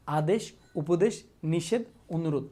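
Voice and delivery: slow, spaced-out list for the class to repeat; slow drill recitation. Shown as a demonstration. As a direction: drill each word so they repeat it. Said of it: "বল, আমার সাথে সাথে বল।". (ধীরে ধীরে মুখস্থ করানোর সুরে)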